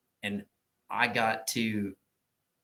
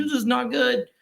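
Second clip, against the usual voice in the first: worried; 13 semitones higher, 7 dB louder than usual. Retold or imitated. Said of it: "I was like".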